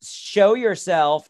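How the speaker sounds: high, loud, drawn-out challenge; mock-bold call to a hidden stranger; show-yourself energy. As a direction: call it into the dark, slowly. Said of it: "type of energy".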